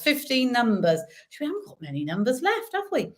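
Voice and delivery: ordinary delivery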